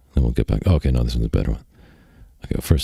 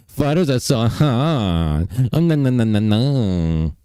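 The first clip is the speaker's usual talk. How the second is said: higher, slow, hammed-up swooning fan; mock swooning girl; 5 semitones higher than usual. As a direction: gush like a starstruck fan girl. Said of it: "Would you go".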